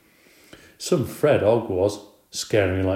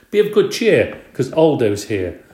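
announced high and loud, a hearty greeting; cheerful announcement; 3 semitones higher, 7 dB louder than usual. A narrator's reading. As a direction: boom it in like a friendly janitor arriving. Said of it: "a voice announced".

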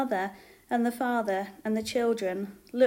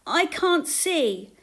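high, drawn-out call for help; 7 semitones higher, 6 dB louder, slower than usual. A narrator's reading. Blind woman calling to Jesus from a crowd, pleading call. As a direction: call out loudly and slowly, pitch falling at the end. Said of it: "called a blind woman".

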